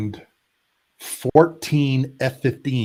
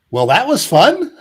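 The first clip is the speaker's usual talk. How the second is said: high, loud, jokey sing-out; mock cheerful understatement; 11 semitones higher, 8 dB louder than usual. Aircrew back home after the mission, mock cheerful. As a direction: toss it out as a loud, high-pitched quip.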